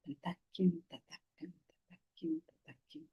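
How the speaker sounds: whispered chant fading to almost nothing; hushed refrain. Acted. Teacher echoing the verse's question, whispered. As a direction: let each repeat sink further under the breath.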